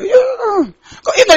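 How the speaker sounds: high, slow, wailing whine; mock crying. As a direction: pretend to sob it out. Crying sound heard.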